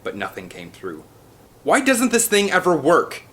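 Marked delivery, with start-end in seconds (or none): 1.6-3.2 high, loud exasperated yell; frustrated yell. A narrator's reading. Ian yells at Eddie over the phone, loud frustrated yell. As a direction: vent at the broken phone. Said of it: "he yelled to Eddie".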